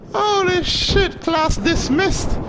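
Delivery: high, slow triumphant drawl; drawn-out exclaim. Real, not acted. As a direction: stretch it out, savoring the win. After winning the fight.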